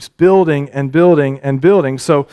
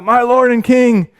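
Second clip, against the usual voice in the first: calling out; 8 semitones higher than usual. Retold or imitated. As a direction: call across to the king, bowing. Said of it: "calls out".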